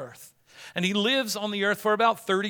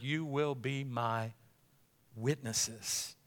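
much softer, drawn out, reverent; hushed and slow; 4 semitones lower, 10 dB quieter, slower than usual. Acted. Pastor quoting Jesus to the congregation, hushed and slow. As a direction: let it sink in quietly.

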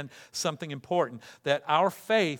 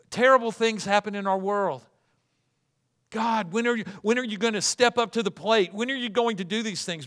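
impatient prayer; 5 semitones higher, 4 dB louder than usual. Acted. Preacher voicing angry prayer to God.

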